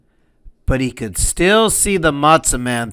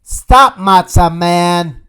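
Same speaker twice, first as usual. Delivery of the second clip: high, loud call of stop; 3 semitones higher, 6 dB louder, slower than usual. Acted. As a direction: shout after him. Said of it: "called Cousin Tilly".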